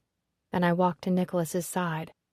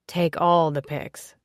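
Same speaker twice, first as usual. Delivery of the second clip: suggestive; 4 dB louder, slower than usual. Acted. Marty dirty wink.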